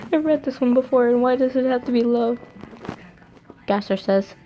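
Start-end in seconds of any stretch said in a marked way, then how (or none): 0.1-2.4 high, fast giggly whine; amused high whine at the story; laughing complaint. Real, not acted. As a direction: laugh and rush the question. Reader repeats complaint laughing.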